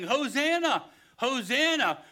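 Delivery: high, loud jubilant shout; crowd shouting hosanna. Acted. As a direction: cry it out like a cheering crowd. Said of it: "those same people who were shouting".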